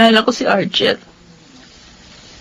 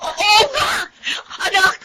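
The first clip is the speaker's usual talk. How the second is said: sobbing cries of panic, rising into shouts; panicked crying; 9 semitones higher, 4 dB quieter than usual. Acted. Mother finds her baby not breathing, panicked sobbing cries. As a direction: cry out for the child in terror.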